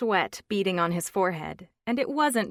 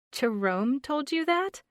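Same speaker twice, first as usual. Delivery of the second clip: higher, rising, stunned woman's question; stunned disbelief; 8 semitones higher, 4 dB louder than usual. Acted. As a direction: ask it as if the floor dropped away. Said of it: "A light wind would have knocked her".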